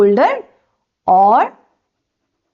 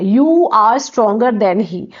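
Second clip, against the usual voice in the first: stretched out, word by word; very slow dictation; much slower than usual. Shown as a demonstration. Teacher dictating the answer to students, very slow. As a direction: lay each word down slowly for them to copy. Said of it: "हमें positive degree में change करना है".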